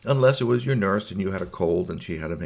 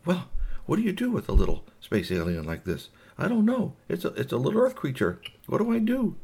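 puzzled alien; 9 semitones higher than usual. Acted. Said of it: "and then he decided".

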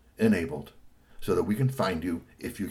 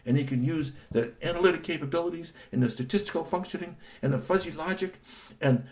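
gushing, high and breathless with excitement; thrilled character going on and on; excited high voice; 8 semitones higher than usual. Acted. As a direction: rattle it off like an overeager kid. Excited character gushing about robot.

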